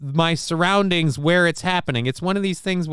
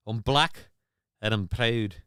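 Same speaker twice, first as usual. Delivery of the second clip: lower, quieter mock Scottish, split in two; imitated Scottish accent; 5 semitones lower, 5 dB quieter than usual. Streamer recalling the ginger saxophonist's line, lightly Scottish. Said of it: "a Scottish accent".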